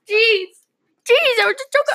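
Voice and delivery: high, loud exasperated jeez. Real, not acted. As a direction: throw it out, exasperated. Exasperated outburst.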